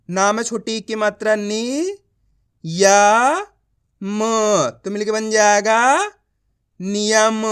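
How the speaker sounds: slow blending drill arriving at नियम; letter-by-letter reading. Shown as a demonstration. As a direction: stretch each piece of नियम before joining it. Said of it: "एक एक अक्षर का उच्चारण कर रहा हूँ".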